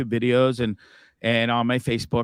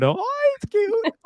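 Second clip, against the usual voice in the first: high, slow mock girly coo; much slower than usual. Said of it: "Sure you do for a hot minute".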